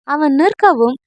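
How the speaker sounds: usual speaking voice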